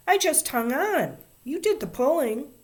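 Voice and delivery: put-on high, loud childish protest; indignant. A narrator's reading. Ramona defending herself to Davy, indignant. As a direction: throw the blame back, pitch way up. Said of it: "she said indignantly".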